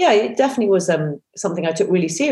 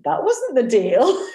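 slow, higher, drawn-out complaint; mock protest; 7 semitones higher, slower than usual. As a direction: play a jokey protest. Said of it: "And I'm like".

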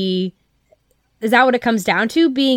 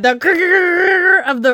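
slow mock grinding grrr; grinding noise; 8 semitones higher, 3 dB louder, much slower than usual. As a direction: drag out the grrr like a roll. Imitating toilet paper roll sound.

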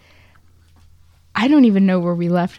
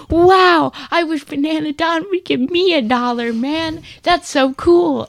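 envious classmate; 9 semitones higher than usual. Retold or imitated. Schoolgirl Audrey voiced in story.

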